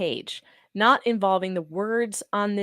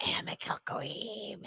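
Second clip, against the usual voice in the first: soft unvoiced Gollum rasp; Gollum whisper; 13 dB quieter, slower than usual. Teasing the lawyer as Gollum, a whispered rasp. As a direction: hiss it like Gollum. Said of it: "When did Gollum become a lawyer?".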